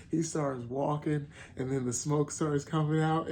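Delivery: ordinary delivery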